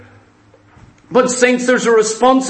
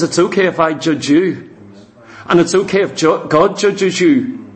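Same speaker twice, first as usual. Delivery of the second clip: lower, mocking the preacher who judges; 5 semitones lower than usual. Mocking the judging preacher to the saints, low and smug.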